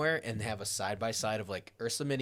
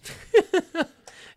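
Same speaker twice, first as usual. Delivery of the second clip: high burst of laughter; 8 dB louder than usual. Real, not acted. Laughs at the joke.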